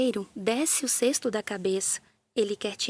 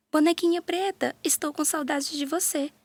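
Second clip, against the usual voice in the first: calling out; 6 semitones higher than usual. A narrator's reading. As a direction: call across the house for her doll.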